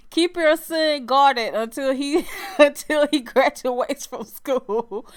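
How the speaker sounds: slow words broken by laughter; laughing through words. Real, not acted. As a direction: warn her while still laughing. Laughing warning to mom.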